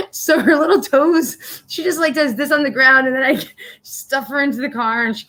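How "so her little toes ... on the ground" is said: laughing to tears. Real, not acted. Telling viewers about her heavy dog, laughing till teary. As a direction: giggle through it helplessly.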